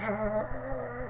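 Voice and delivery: long high-pitched fake shriek; thin drawn-out horror wail; mock scream. Horrified at killing, acted.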